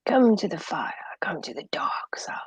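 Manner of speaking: hushed, fading mock-sinister whisper; mock dark side whisper; spooky whisper. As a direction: lure them in like a villain. Joking spooky invitation.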